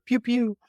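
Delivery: high imitated laser shots; little high pew-pew sound effect; playful pew-pew. Podcaster shouting out another podcast, playful shots.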